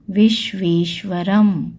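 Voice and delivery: विश्वेश्वरम repeated slowly with a falling end. Shown as a demonstration. Teacher repeating the word for students, slow. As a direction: say विश्वेश्वरम again slowly, clearly. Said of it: "दूसरा शब्द है".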